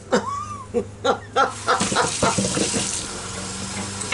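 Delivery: long, breathy real laughing fit; helpless laughing. Real, not acted. Laughing at her own fall, helpless giggles. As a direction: laugh on and on until out of air. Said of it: "I don't know why I'm still laughing".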